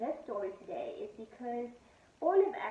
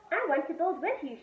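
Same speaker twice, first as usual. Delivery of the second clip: put-on high, eager little girl; pleading to join in; little sister voice; 5 semitones higher, 7 dB louder than usual. A narrator's reading. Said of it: "she said".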